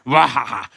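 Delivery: loud cackling laugh at his own joke; hammed-up villain laugh. Character cracking up at his own pun, cackling loudly. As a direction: laugh big, like a cartoon villain.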